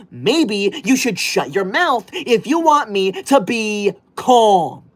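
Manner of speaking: slow, deliberate scolding; slow lecture. Acted. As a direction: drag it out at the rude viewer. Lecturing rude chatter.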